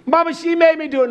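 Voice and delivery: put-on high, fast tattling child; lightly voiced tattling child; whining child. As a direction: blame your sister like a caught kid. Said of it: "You lied on them".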